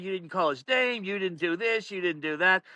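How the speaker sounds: ordinary talk, nothing marked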